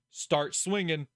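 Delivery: usual speaking voice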